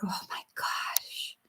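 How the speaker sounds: breathy grieving whisper; hushed whisper. Real, not acted. Grieving owner whispering in shock over her pet's death. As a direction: let it drop to a whisper of shock.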